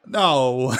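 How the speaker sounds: sudden loud shouted no. Real, not acted. Gamer losing a dice round shouts no. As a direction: blurt it out loud in frustration.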